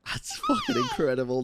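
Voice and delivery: high-pitched laughing exclamation of delight; laughing shout. Real, not acted. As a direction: shout it out through a laugh, thrilled. Reacting to the game's praise.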